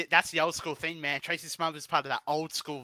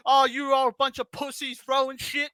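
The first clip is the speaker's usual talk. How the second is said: higher, louder wrestler yelling at the crowd; mock heel promo; 6 semitones higher, 5 dB louder than usual. Wrestler insulting the crowd in a raised mock yell. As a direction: ham up a heel cutting a promo.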